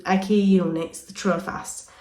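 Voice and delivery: ordinary talk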